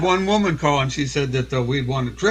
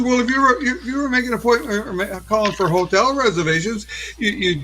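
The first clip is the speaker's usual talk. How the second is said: upset caller; 7 semitones higher than usual. Retold or imitated. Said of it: "She got all upset".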